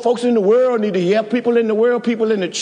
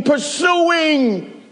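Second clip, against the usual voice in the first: preached cry; 5 semitones higher than usual. Real, not acted. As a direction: lift "pursuing" into a preaching shout.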